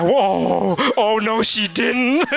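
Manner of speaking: high, laughing gasp of delighted scandal; mock gossip gasp. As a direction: squeal it like juicy gossip. Gossiping about arguing couple.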